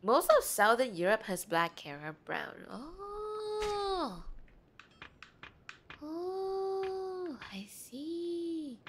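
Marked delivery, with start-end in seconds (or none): 2.7-8.8 high, soft drawn-out "oh"; dawning realisation. Real, not acted. Streamer learning about Southern European hair, soft high realisation. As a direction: coo it softly, way up high. Reacts to being corrected on hair colours.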